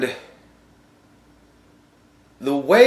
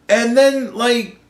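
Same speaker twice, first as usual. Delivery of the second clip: normal talking voice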